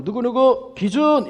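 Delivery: slow, high drawn-out call; teacher calling out. Retold or imitated. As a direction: call the marker name across the field.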